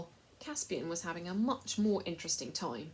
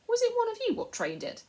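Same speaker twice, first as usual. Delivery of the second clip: much higher, louder mock encouragement; mocking slaver; 10 dB louder than usual. A narrator's reading. Slave dealer teasing the raging mouse, high mocking. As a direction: egg the mouse on for fun.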